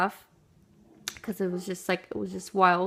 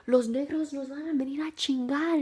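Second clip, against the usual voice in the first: worried parent; 6 semitones higher than usual. Retold or imitated. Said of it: "they were just like".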